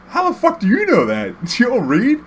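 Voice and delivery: high-pitched disbelieving questions; squeaky-high mock disbelief; incredulous challenge. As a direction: act out baffled outrage with a laugh in it. Acting out his incredulous challenge to friend.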